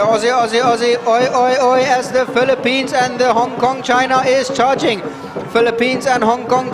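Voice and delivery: live play-by-play building to a loud, high-pitched peak, then easing on the last placing; excited race commentary. Real, not acted.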